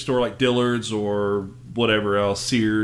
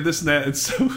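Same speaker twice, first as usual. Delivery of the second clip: ordinary talk, nothing marked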